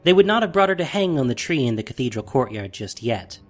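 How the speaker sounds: ordinary talk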